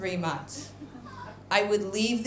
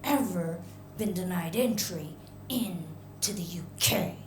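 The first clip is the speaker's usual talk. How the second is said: restrained anger; 3 semitones lower, 6 dB quieter than usual. Retold or imitated. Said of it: "she looks at me real angry".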